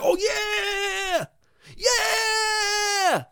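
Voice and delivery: very high, drawn-out shrieked cheer; whole class cheering. Retold or imitated. All the kids cheering the parrot, shrieking. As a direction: mimic a crowd of kids screaming with delight. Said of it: "all of us would be like".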